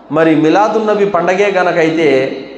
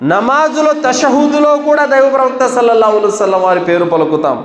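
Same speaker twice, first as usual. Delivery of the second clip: normal talking voice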